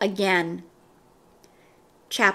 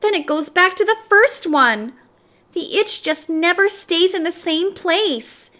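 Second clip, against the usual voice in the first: put-on higher, louder, quick exasperated kid; frantic schoolgirl; 7 semitones higher, 3 dB louder than usual. A narrator's reading. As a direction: rattle off the itching in frustration. Dana explains her itching.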